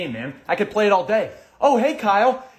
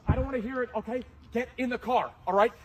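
ordinary talk

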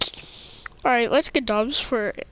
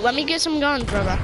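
ordinary delivery

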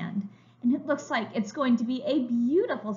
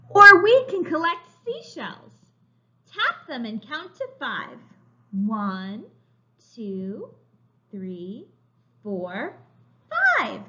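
slow, bright storybook reading; reading to children; 6 dB louder, slower than usual. A narrator's reading. Teacher reading a picture book to young children, slow and bright. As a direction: read it like a picture book for little kids.

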